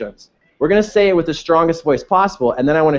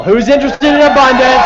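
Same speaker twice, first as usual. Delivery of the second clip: higher, louder crowd call; rallying shout; 8 semitones higher, 6 dB louder than usual. Real, not acted. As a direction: pump up the room. Rallying the audience again.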